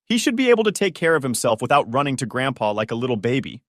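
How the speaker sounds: ordinary delivery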